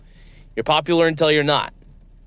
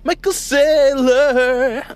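much higher, very slow, drawn-out name; high stretched call; 11 semitones higher, 5 dB louder, much slower than usual. Shown as a demonstration. Host re-announcing the guest in a bigger, higher call. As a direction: sing the name out even bigger. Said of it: "or as I always say".

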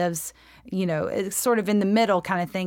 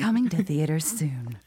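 low mock movie-trailer voice; trailer announcer; 5 semitones lower than usual.

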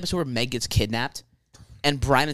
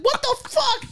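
high laughing squeal of disbelief; shocked Peter; much slower than usual. Retold or imitated. S0 imitating Peter shocked on his return, in a high laughing voice.

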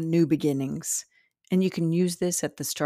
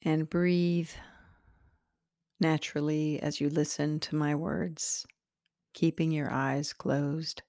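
guided meditation. Acted. Meditation host leading listeners into their heart center, slowly and calmly. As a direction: guide them gently inward, unhurried and spacious.

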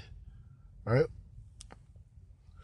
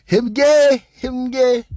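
high, loud mocking call; mocking jeer; 11 semitones higher, 11 dB louder, slower than usual. Real, not acted. Host taunting the rapper, loud high jeer. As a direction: sing it out high and loud to mock.

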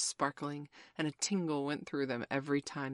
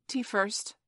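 high, louder, prompt reply; hasty, higher-pitched choice; quick; 6 semitones higher, 5 dB louder than usual. A narrator's reading. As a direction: jump in at once with the answer.

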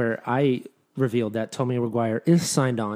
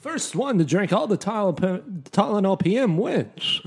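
higher, louder theatrical creep; Patrick Stewart Herbert; 5 semitones higher, 4 dB louder than usual. Pretend. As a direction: deliver it like a stage actor playing a creep. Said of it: "he would be more like this".

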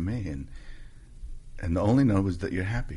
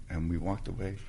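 ordinary talk, nothing marked

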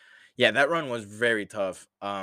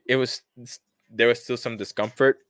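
ordinary delivery

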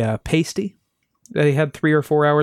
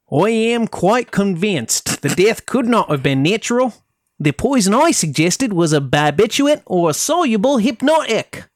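higher, theatrical attempt at an Australian accent sliding into Cockney; hammed-up Australian-Cockney accent; 6 semitones higher than usual. Host quoting the pathologist Cleland, hammed-up Australian accent slipping into Cockney. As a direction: put on a bad Australian accent, drifting Cockney. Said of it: "trying to really embody Cleland in the accent".

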